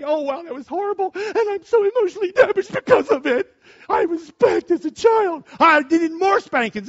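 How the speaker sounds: mocking, high, loud whine; mock whiny complaint. Pastor mocking adults who claim spanking harmed them. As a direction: sneer at the supposedly damaged adult.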